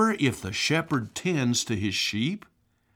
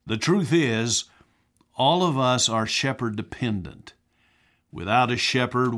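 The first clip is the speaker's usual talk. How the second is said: ordinary delivery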